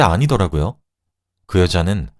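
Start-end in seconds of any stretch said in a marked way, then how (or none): none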